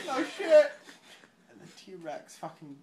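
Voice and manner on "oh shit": high, loud retold alarm; hammed-up scared yelp; mock panic. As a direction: blurt it high, half laughing. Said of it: "and they're like".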